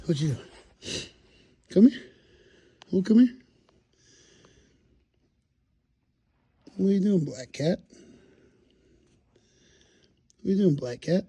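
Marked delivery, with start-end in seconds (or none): 1.7-11.2 high sing-song cat call. Real, not acted.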